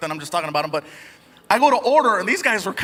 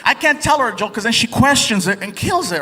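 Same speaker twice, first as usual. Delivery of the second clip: ordinary delivery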